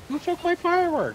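high outraged yell; yelling across the room. Real, not acted. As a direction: shriek in disbelief. His firework got stolen.

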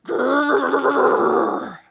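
loud rolling growl; tiger growl. A narrator's reading. Reader imitating the tigers' noise, loud growl. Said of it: "a horrible noise that sounded like".

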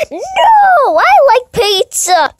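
very high, loud play yell; hammed-up quarrel shout. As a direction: win the pretend squabble by yelling. Plushies quarrel over loving pizza.

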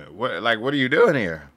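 usual speaking voice

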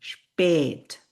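স্পেট as slow "shp-ayt"; German pronunciation demo. Shown as a demonstration. Teacher shows umlaut sound, স্পেট drawn out slowly. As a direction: stretch the umlaut vowel in স্পেট. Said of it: "এ উচ্চারণ হয়".

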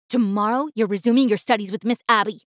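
ordinary delivery

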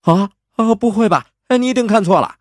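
very high, loud comic protest; flustered, hammed-up disbelief; startled denial. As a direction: deny it in a squeak of mock shock. Hero caught out, denies it.

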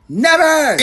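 put-on high, loud childish refusal; defiant yell. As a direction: shout it back like a stubborn kid. Defiant kid refusing to stop.

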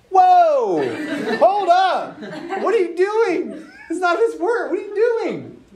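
high, laughing mock alarm; alarmed Jesus. Jesus stopping Peter with the sword, high mock alarm. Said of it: "Jesus is like".

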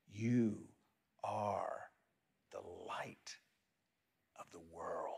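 breathy hush, unvoiced, slow; whisper. Shown as a demonstration. Pastor repeats the refrain to the congregation in a whisper. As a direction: let it fall to a whisper.

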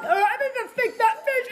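high, laughing mock voice for a smug know-it-all; mocking cocky fish. Gamer mocks the know-it-all fish in a laughing high voice. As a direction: sneer it like a cocky cartoon. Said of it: "he's like".

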